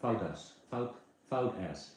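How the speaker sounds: "Fout" said like "fowt", rhyming with out, and slowly; imitating Jasper. Imitating Jasper's "Fout ass" to the viewers, slowly. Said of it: "That is how Jasper said it".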